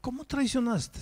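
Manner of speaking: stunned, high incredulous question; shocked disbelief. Retold or imitated. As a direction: pitch jumps way up in astonishment. Pastor shocked at confession.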